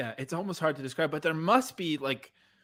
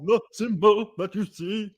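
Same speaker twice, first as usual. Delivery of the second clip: slow stage speaker voice; 5 semitones higher, 5 dB louder than usual. Retold or imitated. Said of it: "so you can hear how he's talking".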